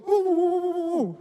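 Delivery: very high, loud playful woo-woo hoots; imitating a friend's hooting. Imitating Clarence rubbing people's heads, high playful hooting. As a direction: hoot like an excited big brother rubbing your head. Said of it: "he would always go".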